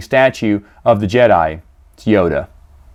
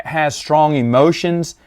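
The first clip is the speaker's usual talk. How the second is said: usual speaking voice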